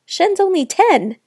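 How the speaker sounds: incredulous. A narrator's reading. Mom shocked at sister's excuse.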